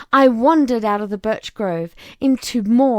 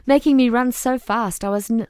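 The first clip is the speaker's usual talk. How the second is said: ordinary delivery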